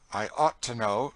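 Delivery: loud, dry retort. A narrator's reading. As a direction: say it dryly, as one who knows too well. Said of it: "said Cassie dryly".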